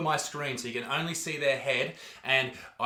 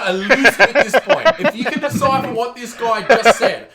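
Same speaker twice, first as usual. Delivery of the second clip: exasperated; 6 semitones higher, 10 dB louder than usual. Real, not acted. Exasperated at incomprehensible clip.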